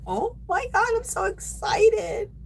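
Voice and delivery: high-pitched, drawn-out gush of delight; excited. Retold or imitated. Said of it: "I was so happy when I seen these".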